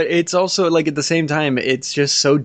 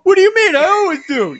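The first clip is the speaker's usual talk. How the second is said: much higher, louder mock protest; mock indignation; 6 dB louder, faster than usual. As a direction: imitate an affronted co-host in a high voice. Mockingly acts out co-host's protest.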